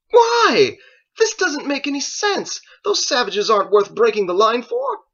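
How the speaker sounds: high, loud, incredulous protest; outraged argument. Acted. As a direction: shout your disbelief at him. Joseph argues with his master.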